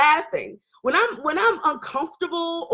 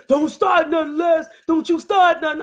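raised, higher mock-scolding voice; agitated warning; 5 semitones higher than usual. Husband warning his wife not to start, agitated. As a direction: warn her off, voice pitched up.

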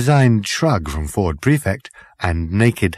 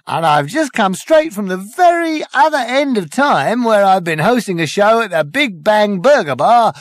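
high, bright-eyed compere patter, louder; showbiz host; 10 semitones higher, 5 dB louder than usual. Acted. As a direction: hype the crowd like a cabaret host. Compere hosting the end of the universe.

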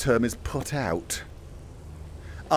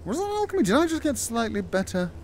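squeaky question; 8 semitones higher, faster than usual. Acted.

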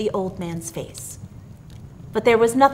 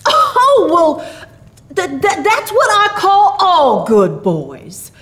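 high, stammering start, then slow and loud; flustered jolly old figure; Santa Claus; 9 semitones higher, 5 dB louder, slower than usual. A narrator's reading. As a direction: stumble into a hearty explanation. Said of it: "explained Santa Claus".

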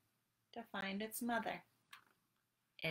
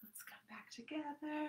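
whisper; 6 semitones higher, 7 dB quieter than usual. Acted. Calming yoga cue.

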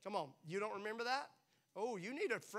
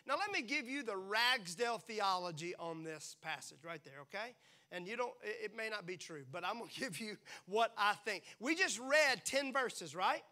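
ordinary talk, nothing marked

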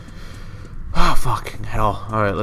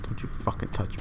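scared whisper; 11 dB quieter than usual. Real, not acted. Panicked under attack.

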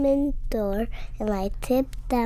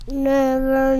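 loud, higher shout of Never; 4 semitones higher, 8 dB louder than usual. Retold or imitated. Mommy yelling at the child never to go down. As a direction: bellow Never like an angry mother. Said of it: "and yelled at me".

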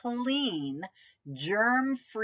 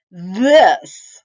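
big monster exclamation; loud germ reveal; 16 dB louder than usual. Acted. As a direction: boom it out like a monster.

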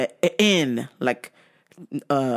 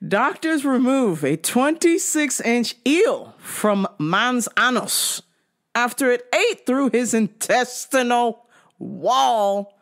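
headline read; 6 semitones higher, slower than usual. Acted. Reads out news headline.

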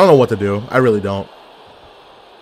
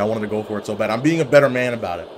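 normal talking voice

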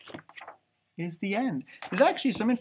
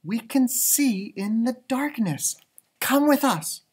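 lightly voiced mother bat, higher and slow; a hint of a calm mother coaxing; reassuring mother bat; 7 semitones higher than usual. Mother Bat gently urges Stella Luna to fly with them.

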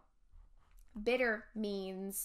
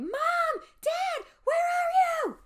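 high, loud panicked yell; screaming for parents; 9 dB louder, slower than usual. Acted. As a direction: scream it out into the empty house. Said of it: "both Josh and I started to scream".

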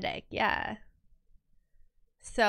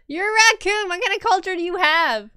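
higher, teasing mock scold; 7 semitones higher, 4 dB louder than usual. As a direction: tease her like a naughty pet. Mock scolding her drawn character.

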